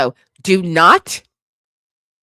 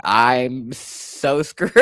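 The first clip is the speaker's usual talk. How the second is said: laughing; 6 semitones lower, 7 dB quieter, much slower than usual. Retold or imitated. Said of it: "I was like".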